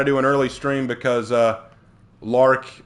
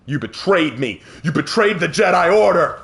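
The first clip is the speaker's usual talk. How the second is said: hammed-up accusation, building louder and higher; mock outrage; 7 semitones higher, 5 dB louder than usual. Player accuses a traitor in game, hammed-up outrage. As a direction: melodramatic cry of betrayal, swelling to the end. Mock betrayal speech, laughs at himself after.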